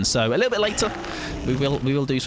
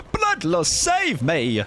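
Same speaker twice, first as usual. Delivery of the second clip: excited plea; 9 semitones higher, slower than usual. Real, not acted. Game panic plea.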